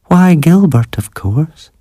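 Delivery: high-pitched mother stating the obvious; old mother figure. A narrator's reading. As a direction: lift the voice well up, as the mother. Mother explains who Gilbert is.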